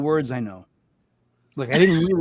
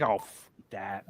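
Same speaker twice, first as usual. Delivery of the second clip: lower, softer, slow and fed up; dismissive groan; 9 semitones lower, 8 dB quieter, much slower than usual. Retold or imitated. Moviegoer reacting to another confusing ending, slow and dismissive. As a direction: brush it off like a tired moviegoer. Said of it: "I'm just like".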